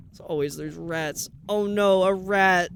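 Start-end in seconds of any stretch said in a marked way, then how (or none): 1.5-2.7 mock alarm. Voicing a rat alert redeem.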